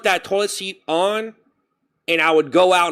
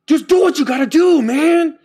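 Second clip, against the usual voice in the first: high, loud self yell; mock-serious yell at himself; 11 semitones higher, 6 dB louder than usual. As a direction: shout at yourself in frustration. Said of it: "I'd yell at myself sometimes".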